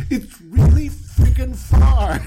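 high, loud, laughing complaint; giggling mock whine about the trip. Abram whining about the trip.

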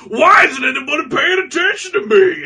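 high, loud hammed-up whine; hammed-up plea for attention; mock whiny shout. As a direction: wail it like an ignored ghost. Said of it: "he's just like".

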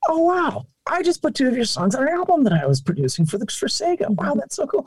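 high-pitched mock amazement; gushing, high retold excitement; imitated amazed reaction. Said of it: "he was like".

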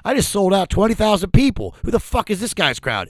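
high, loud mock outrage; indignant. Jelly Roll retelling his reaction to being refused, indignant. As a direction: imitate a star offended at being turned down. Imitates [name]'s offended rant.

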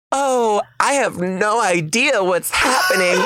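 high, hammed-up innocent surprise; fake cluelessness. Girlfriend fakes surprise for the photo, hammed-up cluelessness. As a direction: overplay having no idea, pitched well up. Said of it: "putting on this fake".

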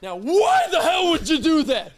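high, loud exasperated yell with a laugh; startled yell. Retold or imitated. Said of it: "and I go".

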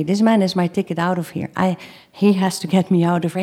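ordinary talk, nothing marked

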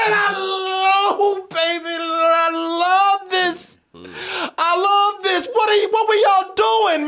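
high-pitched, near-tearful gushing. Real, not acted. Thanking Riot Games and Netflix, gushing high. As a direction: gush like you're overwhelmed with love.

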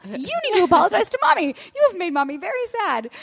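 hammed-up dad scolding; mock scolding. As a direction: scold the child in a put-on voice. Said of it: "Mike is like".

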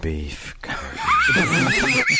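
very slow, high, laughing shout; drawn-out shout. Shown as a demonstration. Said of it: "Say it slow again".

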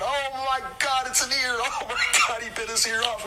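Tommy screaming over the bitten-off ear, high and frantic; panicked screaming. Retold or imitated. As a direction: shriek it out high in horror. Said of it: "starts screaming".

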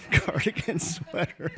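laughing. Real, not acted. Host amused by sweater.

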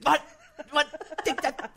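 high, choppy fragments with gaps; breaking-up phone call. Retold or imitated. Musician imitating his manager on a bad boat connection, voice chopped up. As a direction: imitate a caller cutting in and out. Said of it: "you could just hear the internet cutting in and out".

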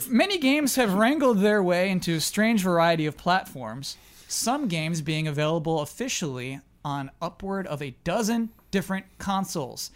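usual speaking voice

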